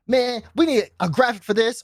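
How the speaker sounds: lightly voiced keen teammate; imitating an eager teammate. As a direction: play the ideas guy pitching. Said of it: "And he's always like".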